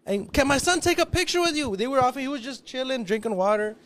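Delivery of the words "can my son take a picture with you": high, loud yelling to get attention; eager fan hollering a name; calling out. Retold or imitated. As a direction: shout across the crowd at him.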